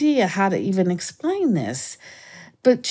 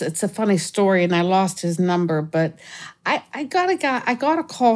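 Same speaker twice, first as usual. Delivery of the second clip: usual speaking voice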